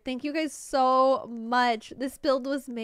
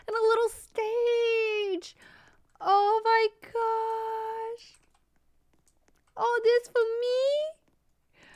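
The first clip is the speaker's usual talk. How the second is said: much higher, slow and drawn out; awed disbelief; 9 semitones higher, slower than usual. Real, not acted. Streamer asking friends if it's all for her, awed. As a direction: marvel slowly, barely believing it. Touched by gift.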